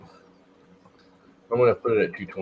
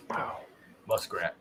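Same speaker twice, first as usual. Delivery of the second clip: normal talking voice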